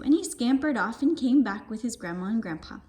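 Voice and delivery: ordinary delivery, nothing marked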